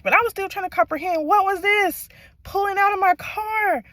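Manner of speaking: very high, loud outburst; high-pitched, shouted incredulity; exasperated disbelief. Real, not acted. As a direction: cry it out, utterly baffled. Exasperated climax of her shocking story.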